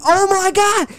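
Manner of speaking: high panicked cry; mock high-pitched panic; retold panic. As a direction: shriek as the wasps swarm you. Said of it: "I'm like".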